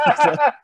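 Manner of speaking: put-on high cackling old lady laugh; a high, loud little cackle. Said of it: "Sort of laughs".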